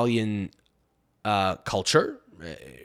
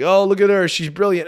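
mock gushing praise; 5 semitones higher, 6 dB louder than usual. Mocking the clapping audience.

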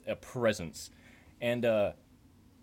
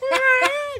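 high, loud squealing fart noise; mock fart, high and blaring; loud fart imitation; 9 dB louder than usual. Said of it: "Like, it was loud".

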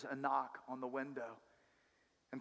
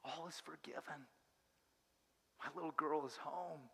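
soft, much quieter, tender comfort; tender hush; 9 dB quieter than usual. Acted. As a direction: hush her gently in a tight embrace. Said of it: "embrace she's ever felt and says".

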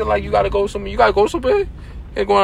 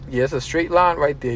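ordinary delivery

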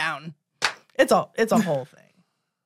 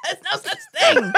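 laughing hard, pitched way up; high laughing; 12 semitones higher, 4 dB louder than usual. Real, not acted. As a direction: squeal with laughter through the words.

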